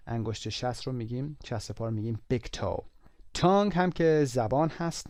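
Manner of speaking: usual speaking voice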